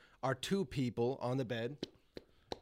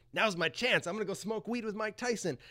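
mildly excited, higher-pitched inner voice; eager resolve; 7 semitones higher than usual. Retold or imitated. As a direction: perk up as if seizing an opening. Imagined eager plan.

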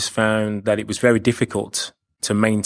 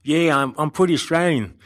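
a deliberately bad Australian accent, pitched up; 6 semitones higher than usual. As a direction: ham up a clumsy Aussie twang. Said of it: "probably the worst Australian accent you've ever heard".

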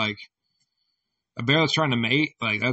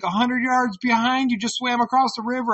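called warning; 10 semitones higher than usual. Retold or imitated. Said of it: "She's like".

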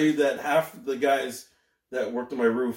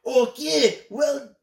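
put-on Jamaican accent; 10 semitones higher, 3 dB louder, much slower than usual. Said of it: "Do a Jamaican accent".

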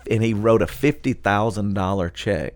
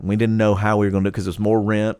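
ordinary delivery

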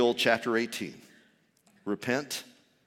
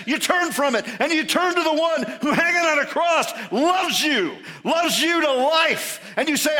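high, loud, impassioned proclamation; full-force preaching; 12 semitones higher, 8 dB louder than usual. Acted. Preacher declares amazing love to his congregation, impassioned preaching. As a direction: cry it out to the whole congregation, leaning hard on key words.

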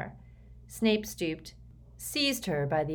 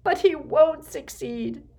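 high, loud, drawn-out wail; slow anguished cry, pitched way up; sobbing; 11 semitones higher, 8 dB louder than usual. A narrator's reading. Mother despairing of her son's chances, sobbing. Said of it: "sobbed Narcissa".

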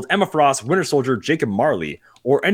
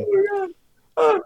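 much higher, cracking Oh my God; shocked exclamation. Real, not acted. Host reacting to his co-host's rant, shocked. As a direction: squeal it out in disbelief. Reacting to awful fan art talk.

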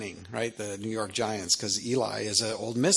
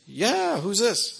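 high-pitched, curious Southern drawl; raised voice, wondering who calls; high puzzled answer; 9 semitones higher, much slower than usual. Retold or imitated. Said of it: "He said".

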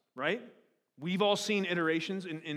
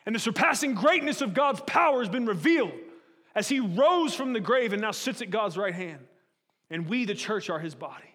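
rising, louder sermon build; preaching; 4 semitones higher, 5 dB louder than usual. Acted. Preacher rallying the congregation, building to a loud peak. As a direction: drive the point home with growing force.

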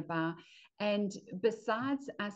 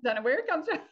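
mock-innocent, pitched up; playful tease; 8 semitones higher, 4 dB louder, faster than usual. Real, not acted. Teasing the host about the competitive family, playful. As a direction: repeat it higher and louder with a knowing wink.

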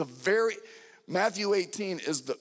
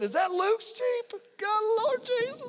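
much higher, squeaky disbelief; astonished squeal; 4 dB louder than usual. Retold or imitated. The pastor retelling spotting his son's Jeep, squealing in amazement. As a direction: play the stunned parent spotting the Jeep.

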